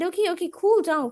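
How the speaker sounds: mock-urgent calming of an upset animal; hurried placating. Owner telling a ruffled cat to cool down, hurried placating.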